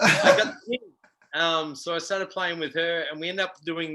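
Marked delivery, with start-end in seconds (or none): 0.0-0.8 triumphant. Real, not acted. Triumphant punchline.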